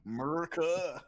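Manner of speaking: loud, high mock American accent; jokey over-the-top American twang; fake American accent. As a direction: belt it out in a hammed-up American accent. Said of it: "it feels like a fake American accent".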